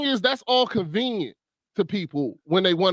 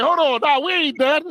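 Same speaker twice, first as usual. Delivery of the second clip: raised, mock-urgent calling after someone; insistent protest; 7 semitones higher than usual. Husband following wife down the hallway, insistent mock protest. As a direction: halt her, pitched up and insistent.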